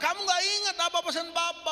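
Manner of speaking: high-pitched, indignant reproach; retold angry outburst. Brother reminding the judge of papa's wish, angry high cry. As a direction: act out a furious brother's protest. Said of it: "dia marah-marah".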